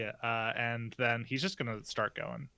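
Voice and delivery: usual speaking voice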